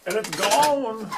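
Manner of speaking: high, slow cry of dismay; exasperated cry. Real, not acted. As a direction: wail that it's gone. Tool missing, exasperated cry.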